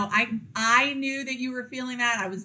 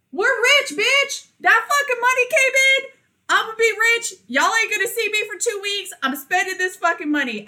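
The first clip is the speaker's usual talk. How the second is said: much higher, much louder gleeful shriek of newfound riches; wild celebration; 11 semitones higher, 5 dB louder than usual. Pretend. Friend imagining her own payday, shrieking with glee. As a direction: scream it like you just won the lottery. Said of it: "I'd be like".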